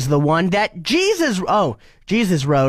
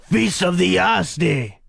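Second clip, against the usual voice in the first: slow, hammed-up French accent. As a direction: say it like a proud Frenchman, drawn out. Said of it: "your French accent".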